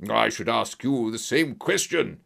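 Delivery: put-on voice of an angry villain; shrieking villain. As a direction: retort furiously in a villain's voice. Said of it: "he shrieked".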